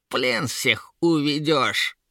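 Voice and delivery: slow, jeering troll-woman voice; Yera mocking. A narrator's reading. Troll-woman jeers at the guard, drawn-out mockery. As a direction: drag out the taunt in a creature voice. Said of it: "засмеялась Ера".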